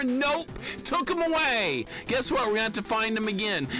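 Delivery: high, yelled complaint; exasperated outburst. Real, not acted. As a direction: call out the bad news in exasperation. Exasperated at lost jumping boots.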